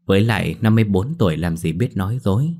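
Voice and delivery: usual speaking voice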